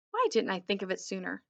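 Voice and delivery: plain reading voice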